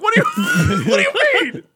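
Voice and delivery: high, laughing what do you mean; laughing disbelief. Real, not acted. Son laughing at dad's comeback, high disbelief. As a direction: laugh through the question. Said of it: "I'm like".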